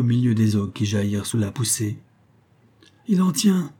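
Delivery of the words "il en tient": pleased exclamation, pitched high; higher, gleeful little outburst; joyful. A narrator's reading. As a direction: crow lightly over a hit. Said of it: "dit Charles Joyeux".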